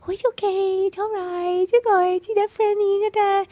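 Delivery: high-pitched whiny coo; cooing baby talk. Retold or imitated. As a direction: coo at the dog in a squeaky voice. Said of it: "coo at them with high-pitched or whiny voice".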